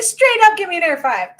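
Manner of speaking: high, loud call across the room; playful yelled invite to the kids; excited call. Retold or imitated. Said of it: "I'm like".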